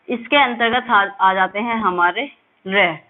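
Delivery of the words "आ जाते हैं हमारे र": ordinary delivery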